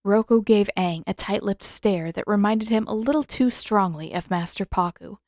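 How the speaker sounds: ordinary delivery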